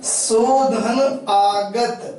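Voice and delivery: "शोधन आगत" slowly, part by part; slow, spaced-out teaching of "शोधन आगत"; word broken into parts. Shown as a demonstration. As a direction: draw out each part of "शोधन आगत" as you split the word. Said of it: "तो क्या करते हैं".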